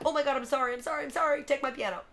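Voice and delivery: mock panicked scream. Embarrassed singer apologizing to musicians they failed to recognize, mock panicked scream. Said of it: "went screaming back".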